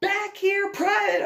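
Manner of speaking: high, loud order from a female officer; commanding shout. Retold or imitated. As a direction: call the private back sharply. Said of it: "I hear a female go".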